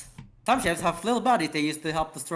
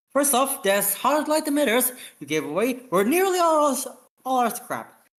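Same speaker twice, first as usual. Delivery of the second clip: raised, higher exasperated scolding; 9 semitones higher, 3 dB louder than usual. Acted. Officer scolds his captain over the scrap, exasperated and raised.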